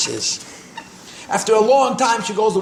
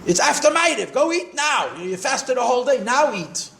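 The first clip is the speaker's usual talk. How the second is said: high-pitched mock scolding, no real volume; mild mock shout, pitched well up; imitated shouting; 6 semitones higher than usual. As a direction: mimic a half-deaf mother-in-law scolding in a high voice. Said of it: "she starts shouting at the Rebbe".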